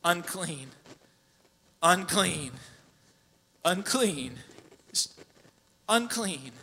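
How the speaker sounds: demonstrating a leper calling behind a hand; a hint of a leper calling out unclean; leper's muffled call. Shown as a demonstration. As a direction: call it out through a hand over the face. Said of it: "mask looked something like this".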